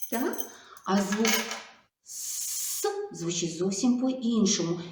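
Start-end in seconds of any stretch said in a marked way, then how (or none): 2.0-2.9 «с» as a soft, quiet hiss; just hiss «с», no voice; voiceless s. Shown as a demonstration. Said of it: "наче такий глухий і тихенький". (тихий глухий звук «с»)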